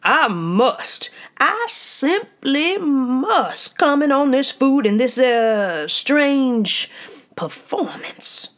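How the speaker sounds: loud, high, self-important pronouncement; pompous critic. Acted. Portly critic addressing the diners, pompous. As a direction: lord it over the room. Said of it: "A portly man coughed pompously".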